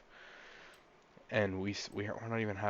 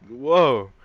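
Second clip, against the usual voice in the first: loud, raised "whoa" acting out shock; retold amazement; 4 semitones higher, 15 dB louder than usual. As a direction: re-enact being floored, much louder. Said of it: "I was like".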